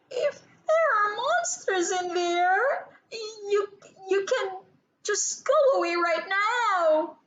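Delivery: high, halting, frightened voice; a child begging the closet monsters to leave; nervous gulping plea. A narrator's reading. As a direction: stammer it out, scared. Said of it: "she gulped".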